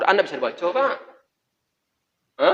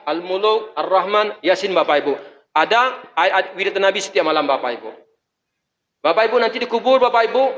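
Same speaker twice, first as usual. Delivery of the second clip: ordinary talk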